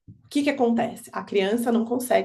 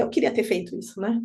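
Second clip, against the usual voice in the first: ordinary talk, nothing marked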